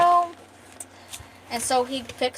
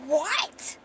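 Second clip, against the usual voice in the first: high, rising yelp of disbelief; shocked; 5 semitones higher than usual. Acted. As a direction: react in hammed-up shock. She is shocked they made out.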